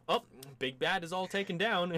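high, lilting feigned surprise; mock nonchalance. Character returning after the fight, high and breezy.

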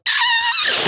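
retold joyful yelp at the surprise; surprised shriek. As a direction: let out a delighted shriek. Reacting to surprise guest.

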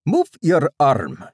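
put-on slow, high, loud Indian-accented instruction; thick Indian accent. A narrator's reading. Doctor directing a patient's movement, slow high Indian accent. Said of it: "he said in a thick Indian accent".

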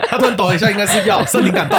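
high, laughing delivery; laughing joke. Real, not acted. Joking to co-host about a patient's twitch, laughing. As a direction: crack up while saying it, pitch climbing.

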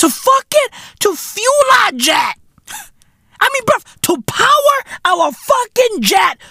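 high, shouting rant; real outraged shout, high; incredulous shout. Real, not acted. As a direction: yell it in disbelief. Ranting disbelief, shouting.

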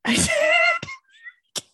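high, eerie wailing whisper; a ghostly, unsettling creepy voice; creepy message voice. Pretend. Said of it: "Do it again".

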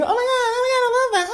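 very high squealing put-on voice; mock gushing fan. Vlogger mimicking fans who love her name, high squeal. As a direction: gush like a starstruck fan. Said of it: "and then".

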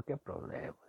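embarrassed whisper to himself. Retold or imitated. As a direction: breathe it out in a mortified whisper. Embarrassed boy's inner reaction.

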